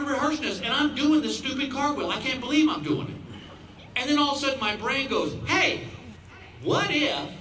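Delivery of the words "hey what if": brain's bright idea. Retold or imitated.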